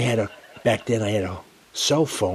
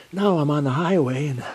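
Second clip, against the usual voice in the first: ordinary talk, nothing marked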